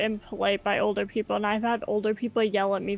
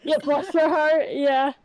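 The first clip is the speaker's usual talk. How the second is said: put-on Southern accent; 5 semitones higher, 6 dB louder, slower than usual. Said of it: "the southern version is".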